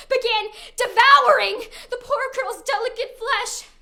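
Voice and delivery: high, loud tragic cry of horror; horrified outcry. Acted. Horror of the burning princess.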